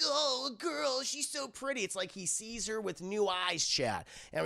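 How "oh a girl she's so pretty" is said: overdone lovestruck sigh of wonder; mock smitten. Smitten boy sees girl anew.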